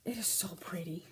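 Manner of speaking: hushed, breathless whisper of delight. Real, not acted. Whispering to viewers over pretty yarn.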